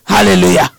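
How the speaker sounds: ordinary talk, nothing marked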